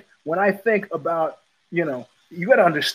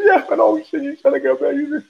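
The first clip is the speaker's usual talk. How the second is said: imitated panic; 11 semitones higher than usual. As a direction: mimic a rattled man babbling back. Panicked man answering in story.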